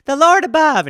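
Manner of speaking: put-on high, loud eager child; mock kid answer. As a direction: chirp it like a Sunday school kid. Kids answering the preacher.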